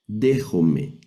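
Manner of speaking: "Dejome" ending on a pure E, falling; diphthong as E. Shown as a demonstration. Teacher repeating the correct Greek reading, final E. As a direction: close "Dejome" on a simple E sound. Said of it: "lo vamos a pronunciar como E".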